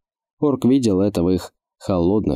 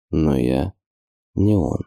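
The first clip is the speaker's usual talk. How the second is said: sullen thought; 3 semitones lower, slower than usual. Acted. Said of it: "хмуро подумал орк".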